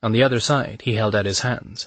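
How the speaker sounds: ordinary delivery, nothing marked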